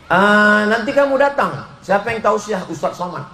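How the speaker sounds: a nosy lady asking; gossiping neighbour. Retold or imitated. Gossiping invitee voiced.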